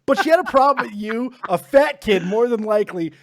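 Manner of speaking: loud, high, incredulous rant; outraged rant. Real, not acted. Indignant rant at teacher.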